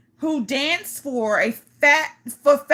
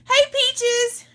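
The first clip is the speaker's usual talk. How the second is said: usual speaking voice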